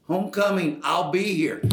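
retold insistence, voice lifted; retold firm declaration. Retelling a talk about travel plans.

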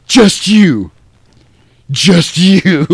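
high, loud overacted challenge; hammed-up movie outburst. Michael challenges David at the cliff edge, overacted and loud. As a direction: throw it out as a mock dramatic yell. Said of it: "So then he's like".

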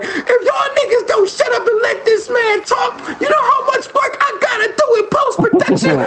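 high, strained yell through laughter. Retold or imitated. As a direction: chew them out at full pitch. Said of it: "He was like".

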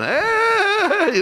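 very high, loud call; cheerful shouted greeting. Retold or imitated. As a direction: call out a happy hey across the room. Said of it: "they're going".

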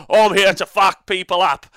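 hammed-up hillbilly accent bellowed; unplaceable rustic accent. Voicing a hillbilly Kragnos player in an unplaceable hillbilly accent. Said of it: "I don't know what accent that is".

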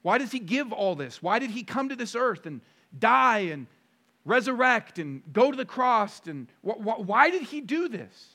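high, loud, urgent rhetorical questions; full-force preaching. Acted. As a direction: preach it hard, pressing each why.

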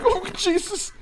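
high, tearful mock gasp; imitating choked-up crying. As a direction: replay the moment the tears hit, half joking. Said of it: "And I was like".

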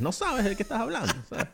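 high mocking fan voice. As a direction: sneer at the know-it-all.

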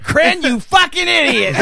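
high, loud, drawn-out insult; gleeful shout. Retold or imitated. Prankster revealing himself to his victim, hammed-up shout. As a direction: yell it as the big prank reveal.

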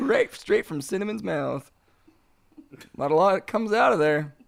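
ordinary talk, nothing marked

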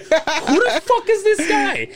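high, loud laughing disbelief; cracking up in amazement; mock disbelief. Seeing Ro backflip onstage, high laughing disbelief. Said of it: "I was just like".

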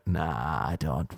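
low, soft refusal; reluctant refusal. Retold or imitated. Actor tells director he won't kiss the teddy, low reluctance. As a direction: drop the voice and back out quietly.

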